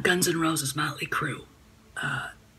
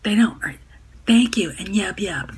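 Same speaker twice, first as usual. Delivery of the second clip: snarky hag voice; 7 dB louder than usual. Retold or imitated. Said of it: "Snarky feminazi, hag voice".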